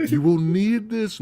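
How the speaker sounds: put-on high, slow pleading old-world voice; village woman imitation. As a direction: urge it on him in a put-on high voice. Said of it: "she's trying to give him the cross".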